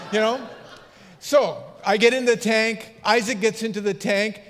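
ordinary talk, nothing marked

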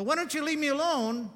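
high, loud cry to be left alone; angry plea. Retold or imitated. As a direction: fling it upward in protest.

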